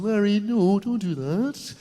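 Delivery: mock posh mum, gliding vowels; put-on posh Scot mum, rising at the end; posh Scottish mum. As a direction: coo it like a posh Scots mother. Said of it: "my mum was going".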